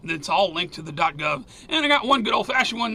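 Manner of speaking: usual speaking voice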